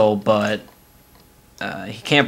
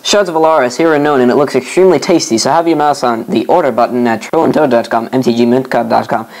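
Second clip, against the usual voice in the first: brisk, louder sponsor announcement; ad read; 4 dB louder than usual. Acted. Reads the show sponsors.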